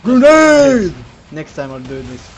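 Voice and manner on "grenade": loud battle yell; shouted. Shown as a demonstration. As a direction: shout the warning out. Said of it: "you should shout".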